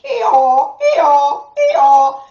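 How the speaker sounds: loud donkey hee-haw; loud braying donkey; frightened donkey bray. Acted.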